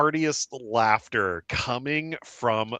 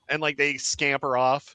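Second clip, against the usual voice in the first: ordinary delivery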